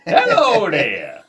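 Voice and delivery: loud, high, long drawn-out greeting; hammed-up salesman. Joining the pretend vacuum pitch, hammed-up greeting. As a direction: stretch out a hammy salesman hello.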